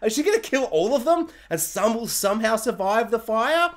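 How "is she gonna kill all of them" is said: shocked. Retold or imitated. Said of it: "I'm like".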